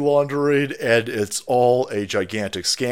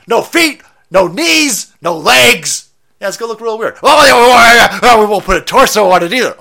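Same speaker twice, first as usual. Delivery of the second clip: high, loud and eager, faster at the end; gleefully one-upping, pitched up; mock excited designer; 10 semitones higher, 11 dB louder than usual. Designer gleefully stripping the statue, high and loud. Acted designer piling on absurd ideas.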